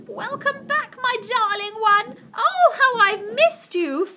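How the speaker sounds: high, loud, slow-drawn delight; joyful cry. A narrator's reading. Missus Silver greets her tortoise after winter, overjoyed high cry. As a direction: cry out with overflowing joy. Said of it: "clap her hands with joy and cry out".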